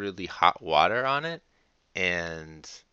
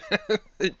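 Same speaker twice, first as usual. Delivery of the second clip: burst of laughter; 13 semitones higher, 5 dB louder than usual. Real, not acted. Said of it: "I don't know that you're helping me".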